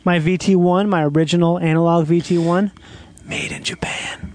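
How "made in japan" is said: slow hushed whisper of pride. Real, not acted. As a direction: breathe it out like a secret. Whispered reveal of Japanese gear.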